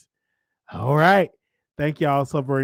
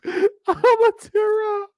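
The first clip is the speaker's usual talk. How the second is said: hammed-up fake sob, much higher; mock crying; 3 dB louder than usual. Host teasing viewers he'll cry, mock sobbing high. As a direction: whimper it out like pretend tears.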